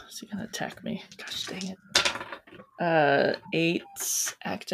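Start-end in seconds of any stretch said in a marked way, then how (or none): 0.1-1.8 under the breath, very soft; almost whisper it to yourself; hushed aside. Real, not acted. Player worries about an attack before rolling, hushed aside.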